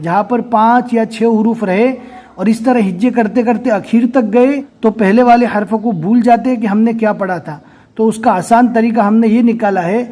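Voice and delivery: usual speaking voice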